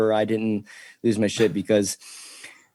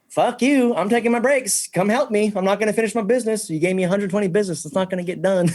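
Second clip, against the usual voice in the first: mock defiant self; 10 semitones higher than usual. Telling off management, retold.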